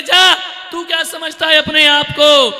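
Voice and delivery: wife shouting at husband; angry quarrel. Retold or imitated. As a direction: challenge him furiously.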